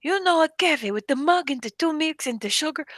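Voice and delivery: high, insistent Jordanian imitation with accent; strong Arabic accent. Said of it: "somebody with a strong accent".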